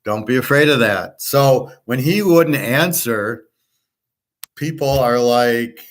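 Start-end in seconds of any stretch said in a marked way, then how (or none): none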